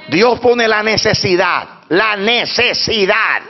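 slow, drawn-out "necesidad"; emphatic preaching. Real, not acted. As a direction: stretch "necesidad" syllable by syllable. Preacher drives home key phrase.

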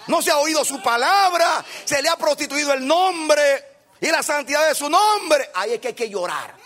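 shouted, high sermon climax; rally the church with every line; fiery preacher hammering each repeated lloren; preaching at full force. Acted. Preacher shouting his main point.

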